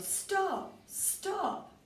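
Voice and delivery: high mock old-lady yell of stop; old lady calling after the runaway, high. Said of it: "The little old lady yelled".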